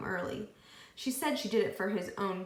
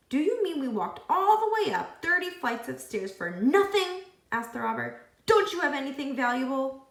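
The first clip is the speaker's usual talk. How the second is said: exasperated robber; 6 semitones higher, 6 dB louder than usual. A narrator's reading. Robber complains.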